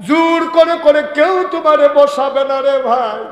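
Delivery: high, loud sermon chant; melodic preaching at full force. Real, not acted. Preacher warning the Muslims of the area nobody will invite them, chanted preaching.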